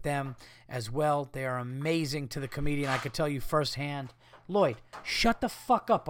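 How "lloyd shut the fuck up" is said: sharp higher snap at the pet; annoyed owner scolding his dog; snapping at the dog. Real, not acted. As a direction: tell the dog off mid-sentence. Yelling at his dog mid-plug.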